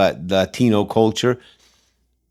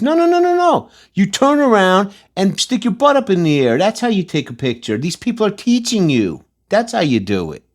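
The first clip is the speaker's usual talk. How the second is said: mock scolding parent; 9 semitones higher than usual. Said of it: "Is my daughter supposed to learn".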